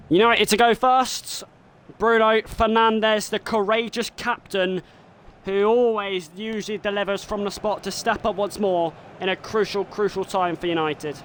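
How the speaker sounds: excited play-by-play, rising with each penalty; live commentary. Acted. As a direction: call the shootout like a TV commentator.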